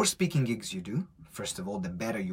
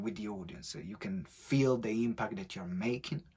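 ordinary delivery

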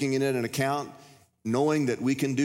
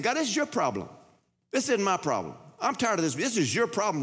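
higher, insistent scolding of God; fussing at God; 7 semitones higher than usual. Retold or imitated. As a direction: hand the problem back, indignant. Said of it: "and I'm just fussing".

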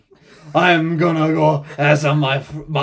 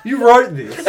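higher and louder, half-laughing accusation; amused disbelief; 8 semitones higher, 6 dB louder than usual. Real, not acted. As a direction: caught out, pointing the finger at the writer. Friend reads the script's accent line.

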